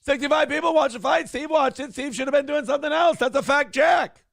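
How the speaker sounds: high, indignant ranting host voice; Kevin Brennan. Acted. Mock host railing about who watched, ranting impression. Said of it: "Mad Dog Russo is like sports Kevin Brennan".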